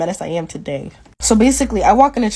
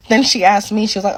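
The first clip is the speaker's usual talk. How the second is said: normal talking voice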